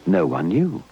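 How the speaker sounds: ordinary delivery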